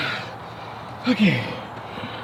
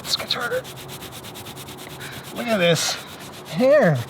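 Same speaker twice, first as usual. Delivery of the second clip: much higher, softer horse demand; pushy horse voice; 6 semitones higher, 5 dB quieter than usual. Pretend. Speaking for the horse Lena, a much higher, softer demand. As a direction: squeak it out as the greedy horse.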